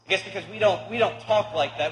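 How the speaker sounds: ordinary talk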